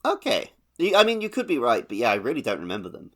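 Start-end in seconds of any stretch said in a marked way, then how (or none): none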